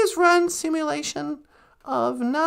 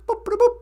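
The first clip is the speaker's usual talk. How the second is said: high, chirpy mouth-made boops; computer beeps; 7 semitones higher, faster than usual. Pretend. Playing holodeck, imitating computer beeps. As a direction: beep like a sci-fi computer.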